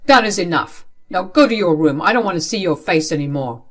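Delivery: loud, firm parental command; angry order. Acted. Father sending daughter to room, angry order. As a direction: shut the argument down loudly.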